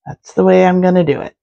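ordinary talk

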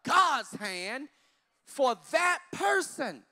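loud, slow preacher call; preaching. Acted. Pastor to congregation, preaching at full force. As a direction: drive the point home from the pulpit.

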